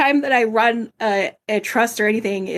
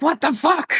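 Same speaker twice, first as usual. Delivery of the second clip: retold yell; 6 semitones higher than usual. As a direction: imitate your own startled outburst, half-hearted in volume. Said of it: "times when I yelled".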